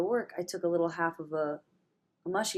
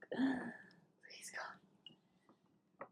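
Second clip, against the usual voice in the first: much softer whispered plea to God; whispered prayer; 7 semitones higher, 12 dB quieter than usual. Real, not acted. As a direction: breathe a prayer under your breath. Pleading prayer aloud.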